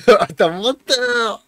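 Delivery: slow laughing accusation. Real, not acted. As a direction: laugh while calling him liar.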